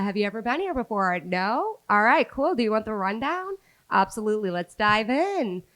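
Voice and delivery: cheerful customer voice rising high on hey; customer service voice. Shown as a demonstration. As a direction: greet a table like a chirpy server. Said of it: "give me the customer, the customer voice".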